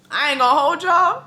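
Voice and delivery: normal talking voice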